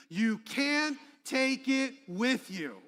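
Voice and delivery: loud, slow, emphatic rallying line; with real conviction. Shown as a demonstration. Preacher leading the congregation to repeat it, with conviction. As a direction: punch each word as if you truly believe it. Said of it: "as if we really believe this".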